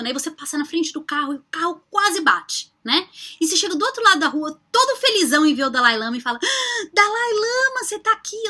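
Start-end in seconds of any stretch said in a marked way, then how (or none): 6.4-8.5 high, slow, squealing delight at meeting an idol; gushing fan. Pretend. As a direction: stretch it out like a starstruck fan. Gushing fan greets Dalai Lama.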